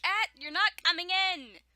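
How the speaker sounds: loud, high yell at a pet; shouting at the cat. Real, not acted. Reader breaks off to tell the cat off, shouting. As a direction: yell it across to the cat, stern and sudden.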